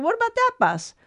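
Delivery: a sudden high hopeful ask; high eager question. Retold or imitated. Sister asks the clerk about the departing bus, high and eager. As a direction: jump way up in pitch, asking hopefully.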